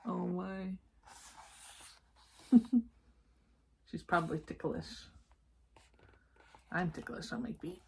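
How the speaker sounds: usual speaking voice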